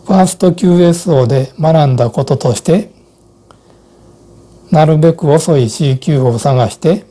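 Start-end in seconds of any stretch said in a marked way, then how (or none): none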